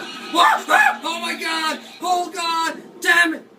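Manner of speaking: very high panicked yells; terrified screaming. Real, not acted. Player caught by the monster screams in real panic. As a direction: scream as the monster grabs you.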